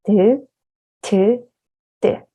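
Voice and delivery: aspirated 트 set against plain 드; contrasting 드 and 트. Shown as a demonstration. Teacher showing aspiration, 드 트 드. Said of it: "and then unaspirated, like this".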